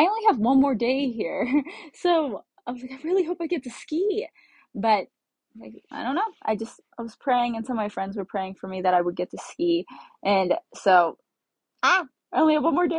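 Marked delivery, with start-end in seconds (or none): 2.9-4.3 high hopeful wish, retold; retold pleading hope. Traveller wishing for one ski day, high and hopeful. 11.8-13.0 high, hurried fretting; retold fretting. Traveller with one day left to ski, high hurried fretting. Acting out worry over last day.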